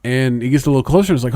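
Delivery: ordinary talk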